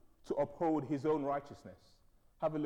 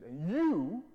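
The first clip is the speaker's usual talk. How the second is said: loud, raised, pointed rebuke; mum's telling-off; 4 semitones higher, 4 dB louder than usual. Retold or imitated. Mum turning on the other son, stern and raised. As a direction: turn on the child and scold firmly. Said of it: "she just turned around to me".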